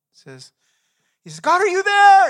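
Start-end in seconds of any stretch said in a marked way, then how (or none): 1.4-2.3 desperate cry for help. Acted. Climber dangling from a cliff cries to God, very high panicked shout. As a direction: scream it up to heaven, hanging on for life.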